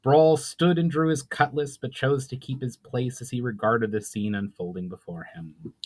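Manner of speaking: plain reading voice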